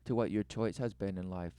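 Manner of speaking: hammed-up solemn preaching; mock sermon. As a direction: preach it like a grave prophet.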